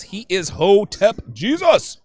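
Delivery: usual speaking voice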